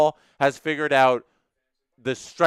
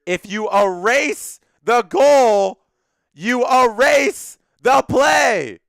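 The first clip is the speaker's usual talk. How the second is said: mock shout; 9 semitones higher, 7 dB louder, much slower than usual. Reading a viewer's chat aloud.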